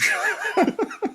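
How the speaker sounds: villain cackle. Retold or imitated.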